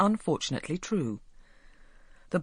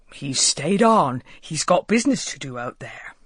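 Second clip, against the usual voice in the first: lightly voiced proud old father; proud old Walter; 6 dB louder than usual. A narrator's reading. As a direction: boast about his son like a fond old villager. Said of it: "explained Walter proudly".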